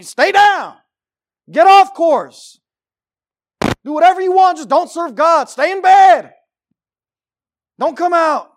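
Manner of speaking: shouted devil's commands, high and drawn out; preacher bellowing as the devil; loud, high orders to give up; devil's discouraging taunts. Acted. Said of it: "That's the devil trying to discourage you, saying".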